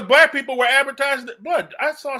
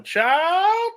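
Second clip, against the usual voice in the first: higher, drawn-out exclamation; 9 semitones higher than usual. Acted. Imitating the woman inside the fried turkey, exclaiming high.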